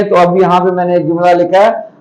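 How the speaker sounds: normal talking voice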